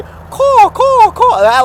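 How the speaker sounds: very high, loud caws; crow cawing. Retold or imitated. Crow fan showing how they call, high imitation caws. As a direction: mimic the crows you love. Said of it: "I love how they".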